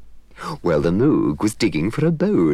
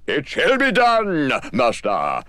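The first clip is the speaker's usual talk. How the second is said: slow, solemn promise; lightly voiced solemn genie; 7 semitones higher, slower than usual. Genie granting the wishes, slow and solemn. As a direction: grant it with ceremony.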